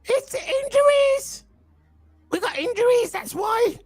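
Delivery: very high, slow whiny mock fan voice; mocking rival fans. Mocking Arsenal fans who blame injuries, squeaky whine. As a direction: mimic whining Arsenal fans making excuses. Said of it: "they're gonna say".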